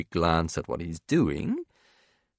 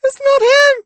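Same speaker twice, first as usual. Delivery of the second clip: put-on very high, loud child wail; piercing upset-kid cry; tearful little boy; 11 dB louder than usual. A narrator's reading. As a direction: protest on the edge of tears. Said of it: "Remington gurgles through incipient tears".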